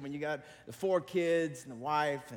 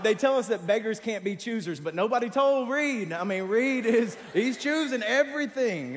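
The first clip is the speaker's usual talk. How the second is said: high, loud, slowing at the end; exasperated rant; 7 semitones higher, 8 dB louder than usual. Retold or imitated. Complaining to the congregation about Reed, loud and exasperated.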